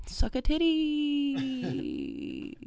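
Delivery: very slow, stretched sign-off; drawn-out outro. Pretend. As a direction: drag every word out. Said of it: "What should our outro be?".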